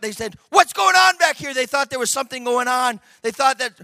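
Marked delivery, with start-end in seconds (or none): 0.5-1.5 loud, higher startled question; crying out. Retold or imitated. Ambulance drivers wondering about the patient, crying out. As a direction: call out in alarm. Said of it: "And they cried out".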